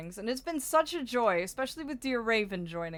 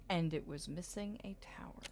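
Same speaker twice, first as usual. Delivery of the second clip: whispering; 3 semitones lower, 9 dB quieter than usual. Real, not acted. Puzzle muttering.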